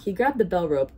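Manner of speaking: usual speaking voice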